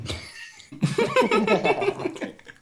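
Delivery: high squeaky laugh with mouth full; weird laugh. Real, not acted. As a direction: laugh through a mouthful of cashews. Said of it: "so my laugh was just weird".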